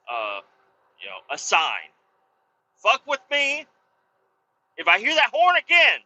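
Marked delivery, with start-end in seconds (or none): 2.8-6.0 high-pitched, raised warning; angry threat. Retold or imitated. Owner warning neighbor against honking that horn, angry threat. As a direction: dare him, heated.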